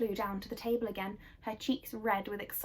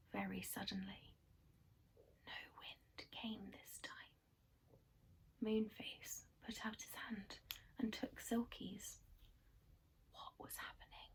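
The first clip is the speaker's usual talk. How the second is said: soft, unvoiced mysterious narration; whispered suspense; 10 dB quieter than usual. A narrator's reading. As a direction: whisper it like a secret.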